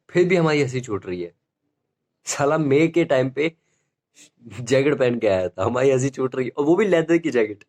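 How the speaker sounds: ordinary talk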